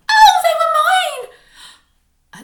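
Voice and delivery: very high, loud, drawn-out cry of shock; the horror of finding paws. A narrator's reading. Boy discovers mouse paws.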